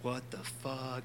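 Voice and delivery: slow, soft disbelief; under your breath, slowly baffled; mild stunned aside; bewildered mutter. Retold or imitated. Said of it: "He's like".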